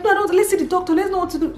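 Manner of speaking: high, urgent demand for the doctor; panicked insistence. Retold or imitated. Mother refusing to calm down, high and insistent. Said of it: "I was like".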